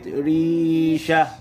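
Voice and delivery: ريشة with a long drawn-out rī; stretched syllable. Shown as a demonstration. Teacher showing pupils the letter ra with kasra, stretched syllable. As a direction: hold the ري in ريشة slowly. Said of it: "ري في كلمة".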